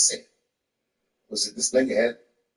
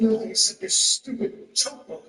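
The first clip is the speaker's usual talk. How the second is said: slow, higher mock-angry wife; mad wife; 7 semitones higher than usual. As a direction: spell out the complaint slowly and indignantly. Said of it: "she was mad at me".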